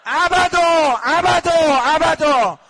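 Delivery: high, loud repeated cry; shouted lament. Real, not acted. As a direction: cry it out to the hall. Crowd shouts abada in lament.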